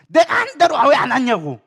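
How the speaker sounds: mock irate outburst; angry demand. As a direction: snap as if fed up with someone. Acted angry demand as example.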